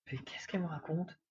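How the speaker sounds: whispered, baffled inner thought. Retold or imitated. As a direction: whisper it to yourself, confused. Baffled inner thought after lady.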